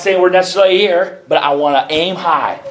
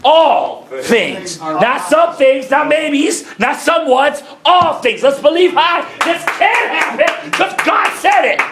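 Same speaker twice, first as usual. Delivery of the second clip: building preaching fervor; 8 semitones higher, slower than usual. Real, not acted. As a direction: build each phrase up toward a peak.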